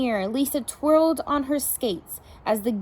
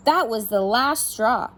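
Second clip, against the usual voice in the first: ordinary delivery, nothing marked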